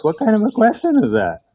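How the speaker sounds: what kind of a question, really?; incredulous. Real, not acted. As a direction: pitch jumps up high in disbelief. Preacher reacts to odd question.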